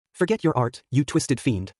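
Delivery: ordinary delivery, nothing marked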